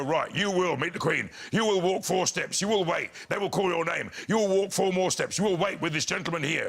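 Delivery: imitated regimented step-by-step instructions; backstage official briefing. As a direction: issue each order as a separate command. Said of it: "backstage they go".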